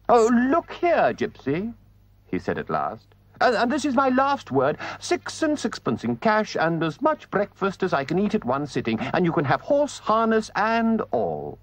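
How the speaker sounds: high, grand bargaining voice; pompous Toad. A narrator's reading. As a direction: lay down terms like a lord. Toad makes his offer.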